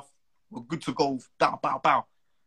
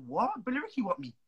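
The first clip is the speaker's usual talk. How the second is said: higher, mock-shocked "What? Billy Ricky want me?"; retold disbelief; 6 semitones higher, 6 dB quieter than usual. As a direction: replay your stunned reaction, pitched up. Retold shocked reaction to news.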